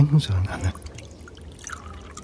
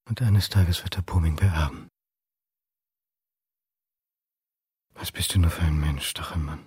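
low unvoiced whisper to himself; whispered thoughts; 5 semitones lower than usual. Man thinking bitterly about a rival, whispered. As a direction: breathe the words as private thoughts. Inner monologue at the reception.

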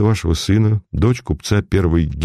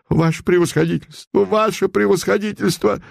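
higher and higher wailing cry; 10 semitones higher than usual. Acted. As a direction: wail it, rising each time. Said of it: "завопила она". (вопль, всё выше и выше)

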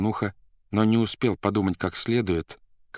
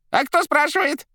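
high, rattling, far higher voice; shaky old voice; 6 dB louder than usual. A narrator's reading. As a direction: rattle it out in a shrill old quaver. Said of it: "дребезжащим голосом".

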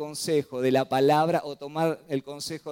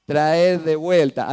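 very slow, louder "Traer de vuelta"; preacher stressing the Hebrew meaning slowly; emphatic repeat; 8 dB louder, much slower than usual. Shown as a demonstration. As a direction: drag out each word of "Traer de vuelta" to drive it home. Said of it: "Es una palabra que significa".

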